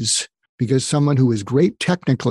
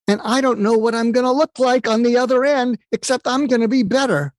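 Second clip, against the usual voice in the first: imitating a friend's giddy voice, much higher; retold giddy joy in a high voice; giddy excitement; 10 semitones higher than usual. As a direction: lift it high and bubbly like a thrilled friend. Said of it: "I'm giddy with excitement".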